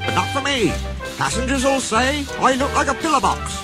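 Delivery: high-pitched indignant complaint; lightly acted huff, pitched high; fuming. A narrator's reading. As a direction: complain crossly in a high engine voice. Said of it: "fumed Mike".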